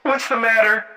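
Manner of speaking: high-pitched, tense call from another room. A narrator's reading. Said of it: "Jenna's tense, high-pitched voice".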